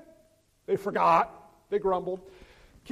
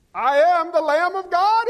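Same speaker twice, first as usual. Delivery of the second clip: higher, louder and slow, a solemn proclamation; voicing Jesus; 7 semitones higher, 10 dB louder, slower than usual. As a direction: announce it as Jesus would, grandly and slowly. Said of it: "is what he's telling these people".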